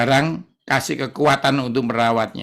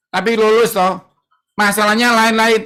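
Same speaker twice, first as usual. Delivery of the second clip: scolding mother; 7 semitones higher, 4 dB louder than usual. Retold or imitated. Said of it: "Katanya mami saya".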